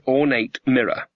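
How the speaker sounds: plain reading voice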